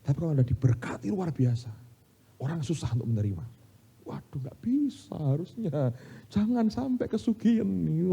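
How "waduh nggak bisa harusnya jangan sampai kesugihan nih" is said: high, slow, drawn-out mimicry; mock disapproval. People who resent another's blessing, mock disapproval. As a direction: imitate begrudging critics in a raised voice.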